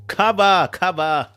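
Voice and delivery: loud playful cry; mock taking cover. Real, not acted. Reader joking about the drama, mock taking cover. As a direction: duck for cover, laughing.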